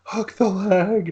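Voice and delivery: high, drawn-out mock scream; slow, high-pitched retold commentator scream; Don Callis screaming. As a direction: imitate a screaming commentator, stretching the words. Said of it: "Don Callis screaming".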